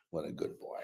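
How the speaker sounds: soft whispered praise for a dog; hushing a pet. Real, not acted.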